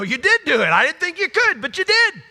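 high, loud astonished exclaiming; amazed cry. Pretend. Believer marvelling to God, high amazed cry. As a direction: gush in wide-eyed amazement. Congregation amazed at God's work.

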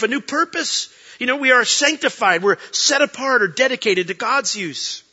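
loud, high sermon climax; full-voiced preacher's peak; preaching at full force. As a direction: preach it out with fire.

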